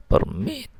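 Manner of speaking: permit stressed on the last syllable, per-MIT, rising; verb stress. Shown as a demonstration. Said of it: "ini bermakna verba".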